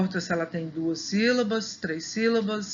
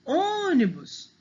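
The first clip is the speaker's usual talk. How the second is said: ÔNIBUS with a high, drawn-out Ô; calling the word; 8 semitones higher, slower than usual. Shown as a demonstration. Teacher showing first-syllable stress, calling ÔÔnibus. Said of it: "o o é mais forte".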